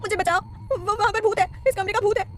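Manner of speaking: high, terrified pleading; trembling voice. Acted. Kaira clutching Virat, begging him to save her. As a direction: cry out in panic about a ghost. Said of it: "अपनी कांपती आवाज़ में कहती है".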